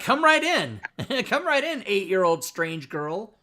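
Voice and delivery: high, loud, sarcastic welcome; mock welcome. Streamer mocking how he'd greet the girl, sarcastic high welcome. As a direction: throw the door open in mock cheer. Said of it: "Yeah, like".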